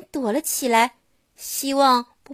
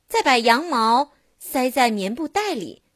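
plain reading voice

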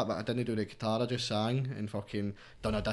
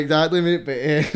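laughing; 4 semitones higher, 10 dB louder, slower than usual. Real, not acted. Laughs at listener's joke.

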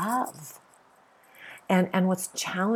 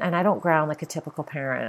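ordinary talk

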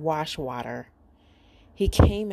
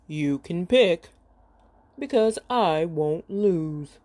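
giant voice. Acted. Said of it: "said Erk".

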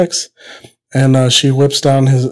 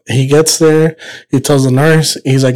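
ordinary talk

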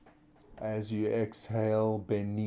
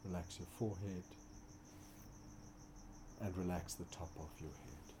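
soft spa whisper; 10 dB quieter than usual. Acted. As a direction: ease them into stillness, growing softer. Guided relaxation in corpse pose.

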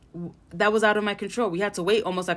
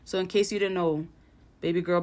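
normal talking voice

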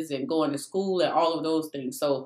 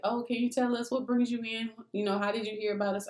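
sweet leasing agent; 6 semitones higher, 6 dB quieter than usual. Retold or imitated. Imitating the leasing lady.

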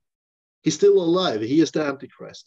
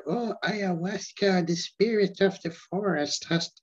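mocking hippie voice; 7 semitones higher than usual. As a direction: send up a dreamy nature mystic. Mocking environmentalists in a put-on voice.

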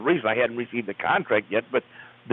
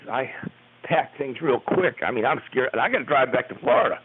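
ordinary delivery

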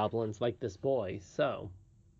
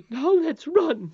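high, loud, drawn-out urging; urgent; 9 dB louder, slower than usual. Acted. Nurse hurrying the children away, urgent. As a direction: urge them on as an alarmed nurse. Nurse urges them to run.

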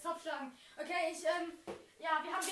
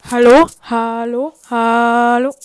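shouting hello, much louder; 7 semitones lower, 21 dB louder, slower than usual. Real, not acted. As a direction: yell it as loud as possible.

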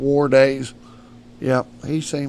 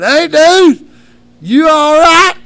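high, loud calling out to a stranger; buddy calling out; 15 dB louder, much slower than usual. Retold or imitated. Friend on the highway calls out to him, high and loud. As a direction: shout it high from the roadside.

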